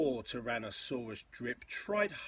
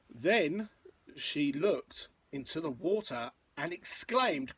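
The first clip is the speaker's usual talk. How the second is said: lilting, rhythmic picture-book read; story narration; 4 semitones higher, 6 dB louder than usual. A narrator's reading. Parent reading a dinosaur rhyme to a child, story narration. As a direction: read in bouncy verse rhythm.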